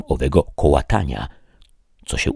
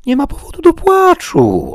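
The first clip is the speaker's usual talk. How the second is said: much higher, loud squire's voice; Sancho coaxing; 6 dB louder than usual. Acted. Sancho consoles the knight waiting for dawn, high and bright. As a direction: chirp it out to cheer your master up. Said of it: "odparł Sancho".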